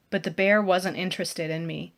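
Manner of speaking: ordinary delivery, nothing marked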